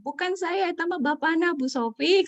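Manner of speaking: high, loud indignant wife denying; wife protesting. Retold or imitated. Retelling the wife blaming her husband, high and loud. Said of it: "Istri bilang".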